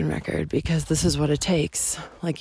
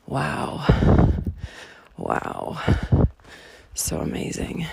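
awed hush; 3 semitones lower, slower than usual. Real, not acted. Taking in the view.

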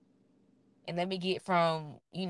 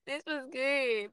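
laughing; 6 semitones higher, 4 dB louder, slower than usual. Real, not acted. Giggling after saying she's high.

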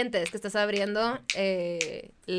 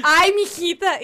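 imitating mom; 7 semitones higher, 8 dB louder, slower than usual. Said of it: "Y me dice".

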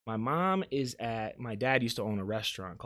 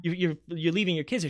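retold scolding, fast and higher, stumbling on "you're"; incredulous scolding; 6 semitones higher, 4 dB louder, faster than usual. Mom scolding aunt about leaving her kids, incredulous. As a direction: imitate an exasperated telling-off.

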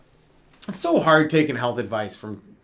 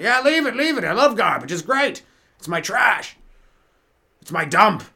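gushing hoarder; 6 semitones higher than usual. Pretend. Hoarder gushing over garbage.

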